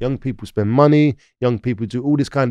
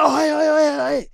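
high, shouted hey-hey-hey of a man being robbed; a victim's high cry of alarm; startled shout; 13 semitones higher than usual. Retold or imitated. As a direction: yell it out in alarm at the thief. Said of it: "He's gone like".

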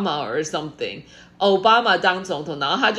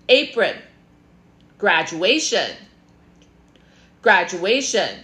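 usual speaking voice

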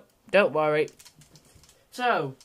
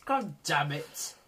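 ordinary talk, nothing marked

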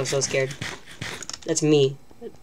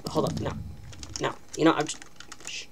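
ordinary delivery

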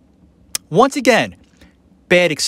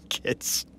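whispered aside; 14 dB quieter than usual. Real, not acted. Wry whispered "Kids." to viewers about toddler breath-holding. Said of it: "Kids, right?".

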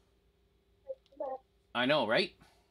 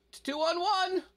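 yelling. Real, not acted. Gamer yelling at teammates.

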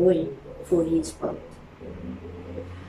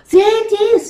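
much higher, louder joyful cry; excited cry; 12 semitones higher, 7 dB louder than usual. A narrator's reading. Boy sees his lost motorboat found, excited cry. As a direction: burst out with delight. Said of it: "cried Harry".

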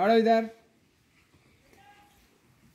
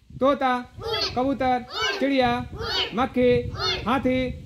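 calling out; 6 semitones higher than usual. Real, not acted. Teacher calls out game words.

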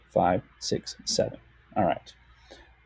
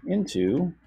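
INTO as two clear beats, in-to, higher; syllable by syllable; 7 semitones higher, 4 dB quieter than usual. Shown as a demonstration. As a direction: stretch INTO into in... to. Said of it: "is two syllables".

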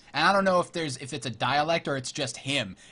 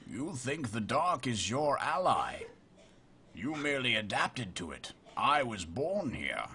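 Bane; 4 semitones lower, 6 dB quieter than usual. Acted. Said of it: "a very good Bane impression".

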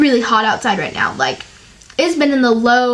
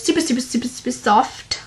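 slipping lightly into a British accent; a touch of accidental British on the words; 4 dB quieter than usual. Real, not acted. Vlogger describing her hair after blow drying, light British accent. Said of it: "said that in a British accent".

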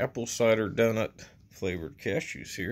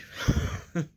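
breathy laugh; 6 semitones higher than usual. Real, not acted. Laughs at own joke.

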